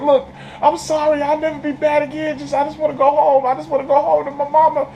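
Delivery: put-on high, pleading little boy voice; little boy pleading with a cop to go home, high; pleading kid. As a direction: beg the cop like a scared kid.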